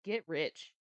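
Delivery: ordinary talk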